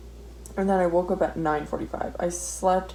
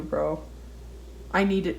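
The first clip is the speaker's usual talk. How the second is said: ordinary talk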